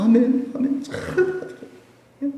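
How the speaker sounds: high, gushing amens; eager women believers. Retold or imitated. Preacher imitating women believers eagerly accepting the word, high.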